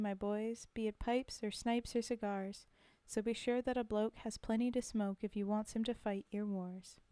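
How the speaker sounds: rhythmic, steady reading of a soldier's ballad; poem recitation. Acted. Reading a war poem to the stream, rhythmic recitation. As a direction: recite it with the swing of the rhyme.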